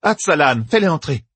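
a high, pressing order; urgent. Acted. Doessine urging the warder, high and pressing. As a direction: hurry the warder along. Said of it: "pressa Doessine".

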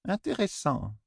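murmured interest; quiet remark. A narrator's reading. Vérine's remark.

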